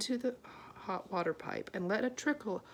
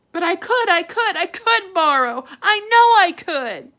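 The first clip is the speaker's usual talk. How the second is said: much higher and louder, pleading; insisting daughter; 11 semitones higher, 17 dB louder than usual. A narrator's reading. Arietti insisting to her mother, high and loud. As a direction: insist with rising passion.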